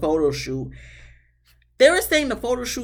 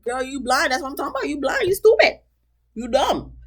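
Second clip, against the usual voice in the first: mock scolding; 5 semitones higher than usual. Friend berates sister retold.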